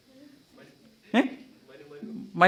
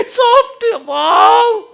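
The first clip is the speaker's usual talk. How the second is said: much higher, louder squeal of delight; delighted Eve; 12 dB louder, much slower than usual. Acted. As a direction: gush like a thrilled girl. (ഹവ്വ ആനന്ദത്തോടെ കൂവുന്നു)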